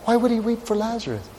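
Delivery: soft, high-pitched wondering; hushed rhetorical question; puzzled questioning. Acted. Repeats the puzzled question, softer.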